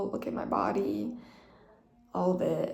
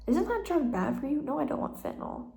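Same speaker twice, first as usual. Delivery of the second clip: stubborn refusal; 6 semitones higher than usual. Retold or imitated. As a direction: push back on the drug like a headstrong patient. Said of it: "your girl's stubborn".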